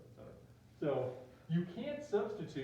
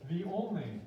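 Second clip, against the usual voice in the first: "only" pushed louder and held; stress on "only"; 3 semitones higher, 5 dB louder than usual. Shown as a demonstration. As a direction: underline "only" with the voice. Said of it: "I'm gonna underline that three times".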